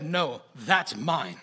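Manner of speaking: very slow, firm correction; God. Retold or imitated. God correcting the preacher in his heart, slow and stern. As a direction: claim it slowly and firmly, word by word. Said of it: "God's quick correction come into my heart".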